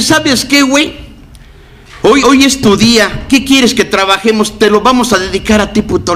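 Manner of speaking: un toque de padrino animado, más agudo; jesuita imitado. Retold or imitated. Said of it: "me dice el pinche jesuita". (el jesuita le ofrece su día, más agudo)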